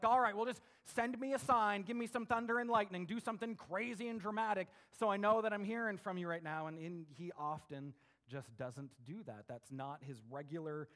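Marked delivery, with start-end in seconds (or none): 0.0-6.7 louder and higher, impatient demand; mock-demand that God speak up; demanding shout. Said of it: "we're like".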